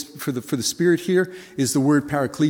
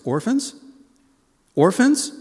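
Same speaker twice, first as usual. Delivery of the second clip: rising, louder disbelief on Orphans; incredulous; 7 semitones higher, 4 dB louder, slower than usual. Acted. A listener shocked to hear Orphans, rising higher. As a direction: echo Orphans in growing shock.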